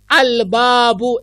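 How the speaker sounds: "अल बाबू" said slowly with the lam sounded; clear al. Shown as a demonstration. Teacher modelling the moon letter for students, clear lam. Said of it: "हमने लाम पढ़ा".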